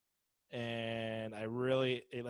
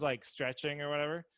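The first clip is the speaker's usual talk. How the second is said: ordinary talk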